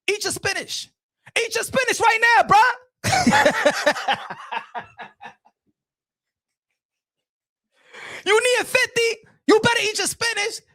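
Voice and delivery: hammed-up nagging order; mock scolding. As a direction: scold him like a fed-up parent. Scolding like a parent.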